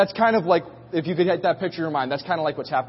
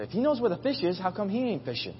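soft, raised-pitch aside; talking among themselves; 3 semitones higher, 6 dB quieter, faster than usual. Retold or imitated. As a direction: imitate grumbling to a crewmate out of earshot. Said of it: "they're talking to each other".

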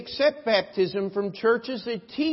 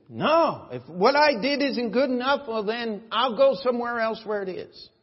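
mock indignant protest, raised voice; indignant objector; 5 semitones higher, 5 dB louder than usual. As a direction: imitate a huffy member, higher and louder. Acts out objecting church members.

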